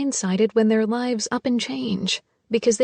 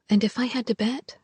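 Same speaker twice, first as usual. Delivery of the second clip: ordinary delivery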